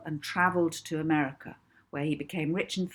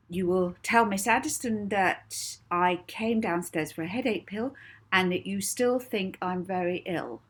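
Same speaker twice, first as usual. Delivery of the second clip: commanding hypnotist; 4 semitones higher, 4 dB louder than usual. A narrator's reading. Molly giving orders to hypnotised cook.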